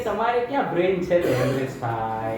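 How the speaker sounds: the wife's put-down, lightly voiced; mock wife's retort. (પત્નીનો મજાકિયો ટોણો)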